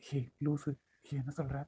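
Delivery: soft secretive murmur; hushed. Acted. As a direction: hush it, teasing a friend. Hushed talk in the room.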